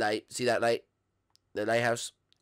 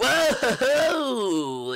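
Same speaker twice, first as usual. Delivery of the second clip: surprised whoa; 11 semitones higher, 5 dB louder than usual. Real, not acted. Milhouse reacts in shock.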